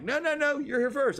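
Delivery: high insistent no, no, no; polite insisting. Retold or imitated. Couple at the ramp insisting he go first. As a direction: press him to go first, politely. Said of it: "They're like".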